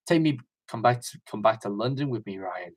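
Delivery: camp King James. Retold or imitated. King James asking Ryan back to London, softly persuasive. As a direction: coax quietly in a lightly camp accent. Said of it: "that accent was so persuasive".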